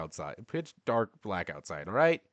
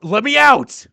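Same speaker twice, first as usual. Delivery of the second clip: even higher, louder desperate scream; 14 semitones higher, 17 dB louder, slower than usual. Acted. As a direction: push the scream to breaking point.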